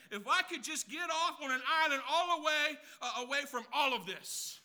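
an exasperated wish to escape everything; wishful escape. Retold or imitated. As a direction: cry out to get away to an island. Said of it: "This is what you say".

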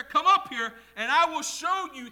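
loud, high summons; calling out. Acted. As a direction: proclaim the command loudly. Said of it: "the voice speaks".

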